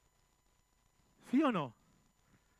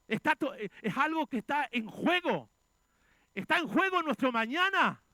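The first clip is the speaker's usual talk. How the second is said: louder, rising pulpit cry, some lines slowed; fiery preaching; 4 semitones higher, 7 dB louder than usual. Acted. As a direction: preach it out to the congregation. Preaching at full force.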